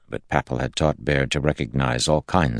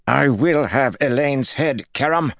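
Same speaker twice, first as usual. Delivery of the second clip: high, tense lord's voice; Jared's voice; 12 semitones higher, 3 dB louder than usual. A narrator's reading. Unhinged lord declaring to his captains, high and tense.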